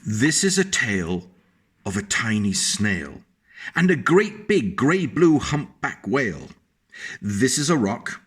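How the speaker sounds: put-on lilting read-aloud voice for a child; sing-song picture-book reading; storybook narration. A narrator's reading.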